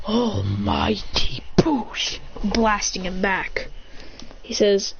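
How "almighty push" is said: whispered technique call. Retold or imitated. Hero kicks All Might aside, slow whispered call. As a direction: whisper the move name very slowly.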